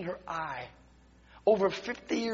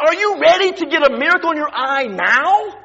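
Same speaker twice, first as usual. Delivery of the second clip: much louder, higher, bold preacher's call; preacher loudly challenging the healed woman; loud challenge; 6 semitones higher, 11 dB louder than usual. Retold or imitated. Preacher challenges woman before miracle.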